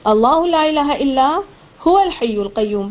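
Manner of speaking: slow, drawn-out chanted recitation; Quran recitation. Shown as a demonstration. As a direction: chant each word long and measured. Shows common mistake.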